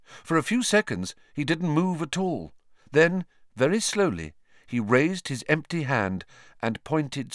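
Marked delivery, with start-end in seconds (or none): none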